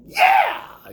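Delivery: a wild happy shout of yeah; excited yell. Acted. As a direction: burst out like the day is already won. Joyful morning outburst.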